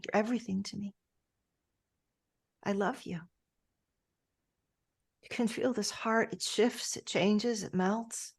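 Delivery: ordinary talk, nothing marked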